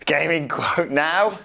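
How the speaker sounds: ordinary talk, nothing marked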